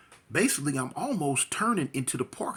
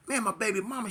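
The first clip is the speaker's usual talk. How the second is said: ordinary talk, nothing marked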